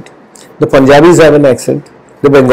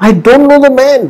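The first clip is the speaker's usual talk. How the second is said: emphatic denial, pitched much higher; sworn denial; 10 semitones higher than usual. A narrator's reading. Peter swearing to his accusers, high emphatic denial. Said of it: "call down curses and he swore to them".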